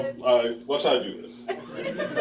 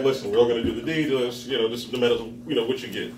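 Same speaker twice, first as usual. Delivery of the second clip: lower, fast sales pitch voice; lightly voiced smooth-talking guy; guy talking her up; 5 semitones lower, 4 dB quieter, faster than usual. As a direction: rattle it off like a smooth talker. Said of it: "he was like".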